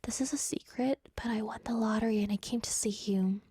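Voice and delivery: secret lottery whisper. Pretend. To a listener, sharing a lottery secret in a whisper. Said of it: "then you could be like".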